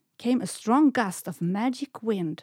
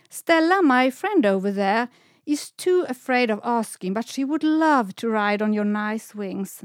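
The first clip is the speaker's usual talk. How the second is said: eager pleading; 3 semitones higher, 5 dB louder than usual. Acted. Semlan eagerly asks the swans to carry her friend, loud pleading. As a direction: talk the swans into it with excitement.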